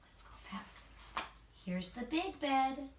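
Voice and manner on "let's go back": a real whispered aside. Real, not acted.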